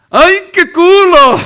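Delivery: high, loud, drawn-out catcall "¡Ay, qué culo!". Retold or imitated. Imitating men catcalling a woman, high loud slow. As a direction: belt it like a street admirer, way up high.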